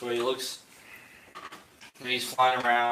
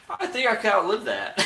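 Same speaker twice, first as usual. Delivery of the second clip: high, laughing quip; giggling comeback, pitched up high; laughing npc line; 12 semitones higher, 7 dB louder than usual. Acted. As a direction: blurt it out through laughter. GM voices NPC amid laughter.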